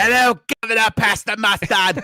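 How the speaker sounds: loud, high, hammed-up British accent; bad British accent. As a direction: shout it like a badly faked Brit. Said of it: "You should have done that governor thing again".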